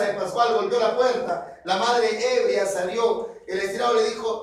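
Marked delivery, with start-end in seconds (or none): none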